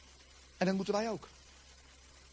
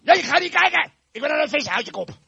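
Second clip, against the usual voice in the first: high, loud, irritated outburst; cross refusal; 9 semitones higher, 11 dB louder than usual. Acted. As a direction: shout him down crossly. Peter angrily refusing John.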